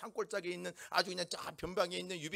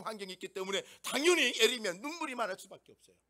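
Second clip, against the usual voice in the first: louder, driving sermon delivery; forceful preaching; 8 dB louder than usual. Acted. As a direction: preach it hard to the congregation.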